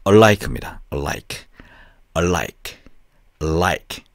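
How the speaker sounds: "alike" as 얼-라익, L held into the next syllable; correct English L. Shown as a demonstration. Teacher drills the word for learners, clear-L "alike". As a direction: hold the L in "alike" and end on bare k. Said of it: "이 L 발음은".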